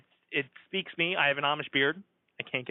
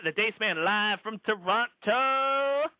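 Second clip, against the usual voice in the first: hype announcer; 5 semitones higher, slower than usual. Acted. Hyping next week's show.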